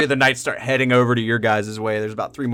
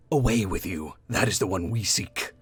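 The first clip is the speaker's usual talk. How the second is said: cold hooded stranger; 5 semitones lower, 7 dB quieter than usual. Acted. Hooded stranger answers the knight.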